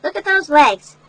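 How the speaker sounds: ordinary delivery, nothing marked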